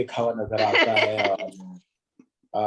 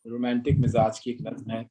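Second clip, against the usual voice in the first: usual speaking voice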